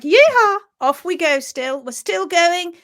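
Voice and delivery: hammed-up happy cowboy cell; gleeful yeehaw. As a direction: whoop as you ride on. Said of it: "The papillae at the heels are like".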